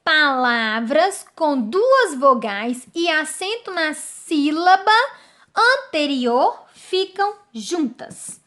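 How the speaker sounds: slow, spaced-out phrases with pauses; slow re-reading. Shown as a demonstration. Teacher reading the rule a second time, slowly and deliberately. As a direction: read the rule out slowly, leaning on each key word. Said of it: "Vamos ler de novo?".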